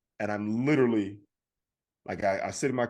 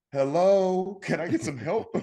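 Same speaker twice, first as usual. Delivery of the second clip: calling out for help; 7 semitones higher, 4 dB louder, slower than usual. Retold or imitated. Calling out for ignored service.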